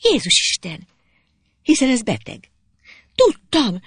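high, worried exclamations; alarmed. A narrator's reading. Zsuzsi seeing that he is ill, alarmed. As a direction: cry out in mild alarm.

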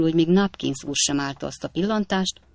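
ordinary delivery

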